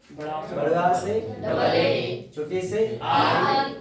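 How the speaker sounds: "बड़ा से डबल ए" said very slowly, letter by letter; slow spelling out. Shown as a demonstration. Teacher spelling letters slowly to children. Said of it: "अब इन अक्षरों को देखिए".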